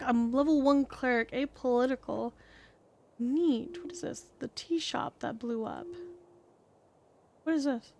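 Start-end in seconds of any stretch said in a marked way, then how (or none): none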